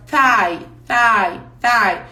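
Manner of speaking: "Thigh" said three times, each with a falling tune; repeated model pronunciation. Shown as a demonstration. Teacher drills "thigh" for the class. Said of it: "thigh significa coxa".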